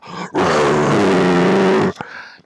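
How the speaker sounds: sudden loud frightened gasp; a big startled gasp. Retold or imitated. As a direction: gasp as if jumping out of your skin. Gorilla scare retold.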